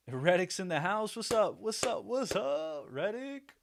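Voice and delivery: high, rising singsong what's-up; excited greeting. Real, not acted. Host greeting Reddick as he joins, excited. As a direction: hail a latecomer in a climbing, hammed-up voice. Greeting a viewer who just arrived.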